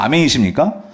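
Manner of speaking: ordinary talk, nothing marked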